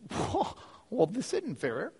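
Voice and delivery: mock-indignant, high-pitched disbelief; acted affronted brother, voice swooping high; indignant older brother. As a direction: whine it like a slighted sibling. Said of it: "older brother's sitting there watching all this thinking".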